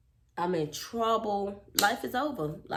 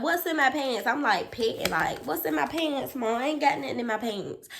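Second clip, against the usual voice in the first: higher, louder feigned innocence; innocent protest; 6 semitones higher, 3 dB louder than usual. Retold or imitated. Child denies hiding anything from mom, protesting. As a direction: play the kid denying everything.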